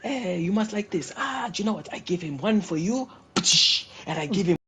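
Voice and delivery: loud, high-pitched impression of him; imitating the guy. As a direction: act him out big and high. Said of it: "I'll give you an example. He's like this".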